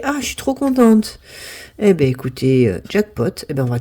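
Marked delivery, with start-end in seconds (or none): 0.0-1.2 delighted. Real, not acted. Celebrating win.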